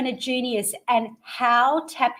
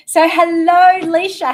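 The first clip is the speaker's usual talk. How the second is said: big, loud, higher hello; loud greeting; 6 semitones higher, 9 dB louder than usual. Real, not acted. Host welcoming guest Leisha onto the show with a big loud hello. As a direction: call it out like opening the show.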